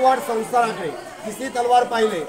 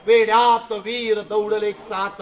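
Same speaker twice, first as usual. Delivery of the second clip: slow, stretched heroic verse; ringing, drawn-out line of verse; poem recitation; 4 dB louder, slower than usual. Shown as a demonstration. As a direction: declaim the line slowly, drawing out each word.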